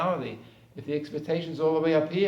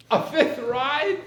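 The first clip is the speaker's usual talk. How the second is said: put-on high, slow disbelieving child; surprised child; 9 semitones higher, 6 dB louder, much slower than usual. Child to father offered an extra ride, high slow disbelief. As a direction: ask it like you can't believe your luck.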